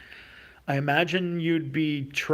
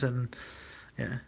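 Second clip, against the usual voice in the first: ordinary delivery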